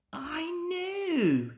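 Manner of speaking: very high, breathy gasp of delight; gleeful realization. A narrator's reading. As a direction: catch the idea with glee. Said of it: "he thought".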